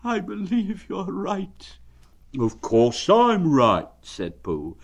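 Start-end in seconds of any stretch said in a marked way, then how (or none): 0.0-1.8 high, slow, sighing donkey voice; sad sigh. Acted. Eeyore admitting the tail is gone, sighing sadly. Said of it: "with a long, sad sigh". 2.4-3.9 higher, louder, slow friendly bear voice; cheerful bear. Acted. Pooh asking Eeyore how he is, friendly.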